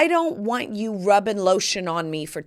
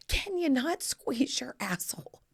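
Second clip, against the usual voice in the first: hissed, whispered scolding; mortified whisper; 5 semitones higher, 6 dB quieter than usual. Retold or imitated. Daughters scolding mom at check-in, mortified mock whisper. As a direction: scold under your breath, mock-appalled.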